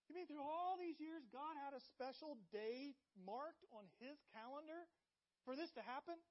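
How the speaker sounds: high and loud, breathless disbelief; astonished realization. Acted. A believer realizing God's timing, high astonished excitement. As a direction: stop everything in sudden amazement.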